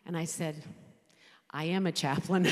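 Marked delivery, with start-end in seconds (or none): 1.5-2.5 loud laughing punchline. Real, not acted. Widow laughing as she tells the congregation. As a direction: burst out laughing at the irony.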